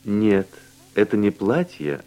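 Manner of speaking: normal talking voice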